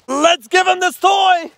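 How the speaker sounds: slow, loud, excited call to the dog. Real, not acted. Owner rousing his poodle, excited shout. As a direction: rev the dog up with the toy.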